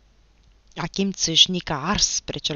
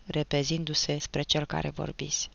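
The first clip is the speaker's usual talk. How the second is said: ordinary delivery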